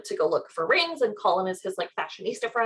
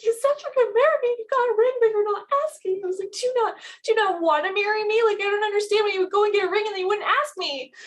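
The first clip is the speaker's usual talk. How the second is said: high-pitched, hurried tearful wail; mock weeping; 11 semitones higher, faster than usual. Girlfriend asking why he hasn't proposed, mock weeping. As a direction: imitate a weepy, rushed complaint. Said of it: "I'm, like, very heavily weeping".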